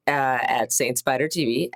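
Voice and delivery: ordinary talk, nothing marked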